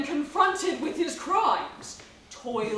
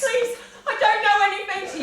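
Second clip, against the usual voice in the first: high, fast, panicked shouted plea; terrified pleading; 8 semitones higher, 6 dB louder, much faster than usual. Acted. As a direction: beg for your life in a rush. Victim begs for her life.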